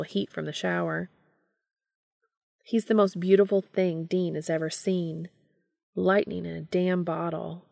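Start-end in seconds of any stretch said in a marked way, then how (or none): none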